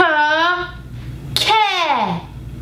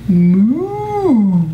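cow moo; 5 semitones lower, 4 dB louder than usual. Acted. Cow puppet moos for kids.

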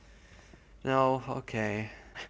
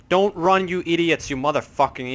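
loud, higher scolding that eases off; exasperated yell; 5 semitones higher, 7 dB louder than usual. Real, not acted. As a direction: shout a warning at fools running off. Yelling at fleeing game characters.

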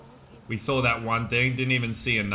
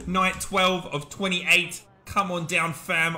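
mock English accent host, a bit higher; 5 semitones higher, slower than usual. Streamer inviting viewers to his show, mock English accent. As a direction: put on a hammy English announcer. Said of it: "I got all English up in here".

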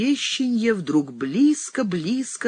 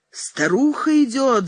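high, raised cry from below; shouted warning; 8 semitones higher, 4 dB louder than usual. A narrator's reading. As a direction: call out the alarm, pitched up. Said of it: "закричали внизу под крыльцом".

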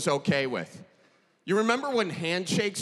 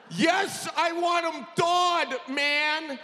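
exasperated friend voice; 7 semitones higher than usual. Retold or imitated. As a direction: insist like a stubborn friend. Acted quote, irritated friend.

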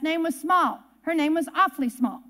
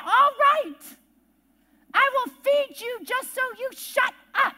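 higher, fed-up retort; exasperated giving in; 5 semitones higher than usual. Retold or imitated. Owner giving in to nagging cat, acted exasperation. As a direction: give in crossly, pitched up.

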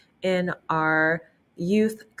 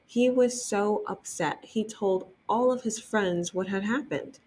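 usual speaking voice

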